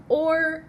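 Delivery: "or" set apart, high and loud; isolated syllable. Shown as a demonstration. Teacher sounds out the first syllable, "or" isolated. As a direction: sound out "or" as one strong chunk. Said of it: "your first syllable is".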